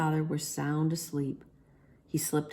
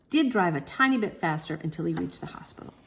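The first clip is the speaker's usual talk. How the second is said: plain reading voice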